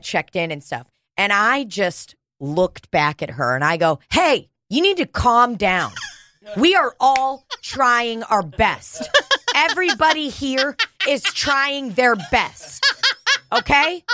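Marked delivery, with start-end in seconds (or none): 4.1-14.0 higher, slow, firm scolding with a laugh; firm call-out. Retold or imitated. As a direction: calmly tell her off, word by word. Calling out woman in waiting room.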